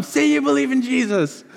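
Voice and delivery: high-pitched mock yell; drawn-up, high bullying cry; imitated child bully. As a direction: play a little kid demanding a confession. Imitating a seven-year-old beating a boy.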